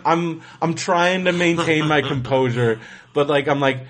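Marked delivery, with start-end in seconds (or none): none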